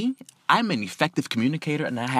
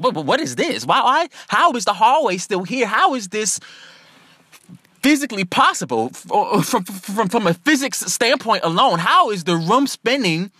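higher, stammering bewilderment; baffled disbelief; 6 semitones higher than usual. Retold or imitated. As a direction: stammer it out, unable to make sense of it. Acting out baffled disbelief at room.

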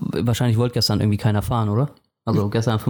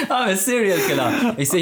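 laughing; 11 semitones higher than usual. Real, not acted. Laughing at the mishearing.

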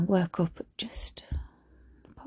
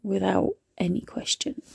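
usual speaking voice

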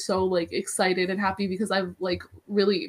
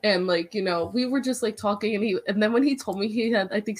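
ordinary delivery

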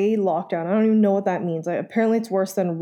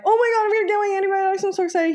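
high, fast, gushing mock excitement; 10 semitones higher, much faster than usual. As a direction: squeal it out, hammed-up. Retold excitement over antibiotics.

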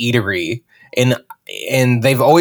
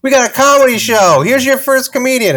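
high-pitched host announcement; mock announcer; 12 semitones higher than usual. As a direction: announce the act, suddenly chipper. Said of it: "then she's like".

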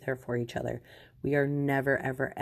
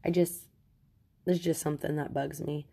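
usual speaking voice

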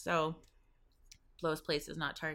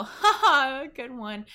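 polite fake laugh; 7 semitones higher, 7 dB louder, slower than usual. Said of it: "like, go".

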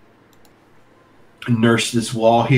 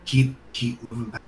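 trying to whisper; 6 dB quieter than usual. Acted. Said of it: "I can't whisper. I'm whispering".

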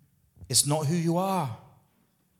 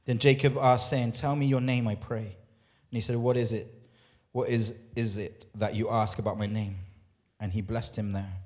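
1 scripture reading; 5 semitones lower, 5 dB quieter than usual. Acted. Reads scripture passage.

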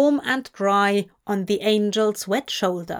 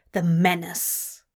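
plain reading voice

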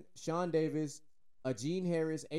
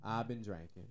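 put-on Texan accent; 6 semitones lower, 6 dB quieter than usual. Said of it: "I'll translate it for you in American Texan".

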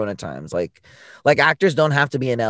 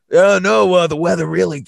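higher, louder sheepish excuse; mock buddy excuse; 6 semitones higher, 6 dB louder than usual. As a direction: play the friend caving in. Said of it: "be like".

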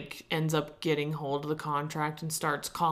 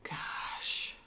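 whispered; 9 dB quieter than usual. Real, not acted. Stunned reaction to church fight.

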